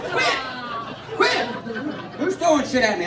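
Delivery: high-pitched yelp of annoyance; short, high cry to make it stop; mock protest. Friend pelted at the bar, high mock protest.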